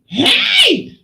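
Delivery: high-pitched mimicked holler; explosive shout. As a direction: imitate his sudden high yell. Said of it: "quite an explosive personality".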